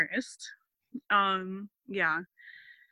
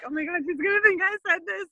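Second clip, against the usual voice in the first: high, loud, fast panicked gasp; 10 semitones higher, 6 dB louder, faster than usual. Retold or imitated. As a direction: rush it out in mock panic. Speaker's panic retold, quoted.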